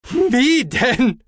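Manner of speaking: high, loud gloating cry; triumphant. A narrator's reading. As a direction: crow it in triumph. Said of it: "stieß Aspartamin triumphierend aus".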